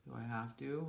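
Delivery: a hint of a grudging husband; reluctant husband. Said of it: "And he was like".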